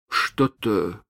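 hesitant, faltering Что-то; unsure. Acted. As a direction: say Что-то haltingly, shaken. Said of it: "произнёс он неуверенно".

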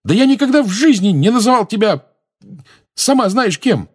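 high, loud and outraged; worked-up defensive yell; indignant protest. A narrator's reading. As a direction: protest hotly, pitched right up. James protests in the quarrel.

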